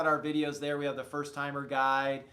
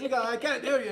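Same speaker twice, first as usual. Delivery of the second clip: imitating a gruff Italian shopkeeper, pitched up; Italian accent, gruff; 6 semitones higher, faster than usual. Ice cream man complaining to a customer, gruff Italian accent. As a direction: put on a rough Italian accent. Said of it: "he's got the Italian accent".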